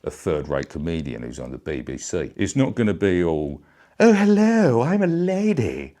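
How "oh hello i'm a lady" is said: much higher, loud mock falsetto lady; bad lady impression. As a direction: ham up a third-rate comic's lady voice. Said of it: "very bad impression of a lady".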